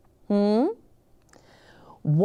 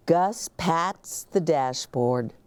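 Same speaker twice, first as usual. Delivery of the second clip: plain reading voice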